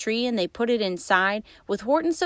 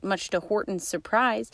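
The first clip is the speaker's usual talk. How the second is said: plain reading voice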